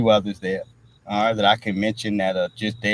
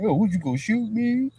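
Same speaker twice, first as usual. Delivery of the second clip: much higher, taunting the gunman; acted movie taunt; 11 semitones higher than usual. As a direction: goad him, voice pitched way up. Reenacting the film scene.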